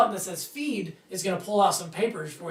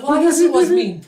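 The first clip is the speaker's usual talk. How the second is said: mock concession; 7 semitones higher, 8 dB louder than usual. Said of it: "I'm like".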